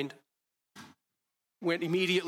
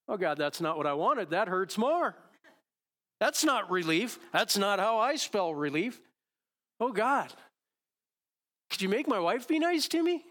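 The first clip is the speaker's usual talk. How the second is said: rising, pleading whine to God; whiny complaining prayer; 5 semitones higher than usual. Retold or imitated. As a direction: complain to God like a frustrated child. Pastor acts his whining prayer.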